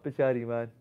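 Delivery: ordinary talk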